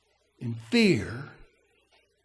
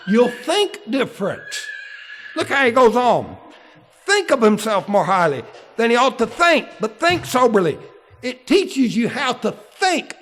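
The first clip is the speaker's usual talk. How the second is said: loud, full-force pulpit preaching; fiery preaching; 4 semitones higher, 6 dB louder than usual. Acted. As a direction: thunder the verses at the congregation.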